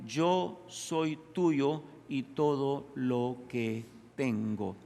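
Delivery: very slow, measured submission; slow, deliberate yielding; slow surrender. Acted. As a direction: draw out each word as you yield. Reads king's total surrender.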